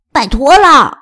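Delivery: loud, pleading little dog; insistent plea. Acted. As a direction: beg out loud. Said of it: "小布请求道".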